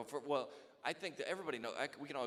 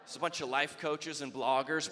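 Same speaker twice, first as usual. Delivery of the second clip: ordinary talk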